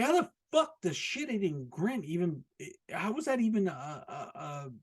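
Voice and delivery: ordinary talk, nothing marked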